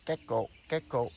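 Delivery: loud imitated gecko chirp; mimicking the gecko's chirp, loud; gecko call. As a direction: call it out like the little lizard. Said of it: "that makes the sound".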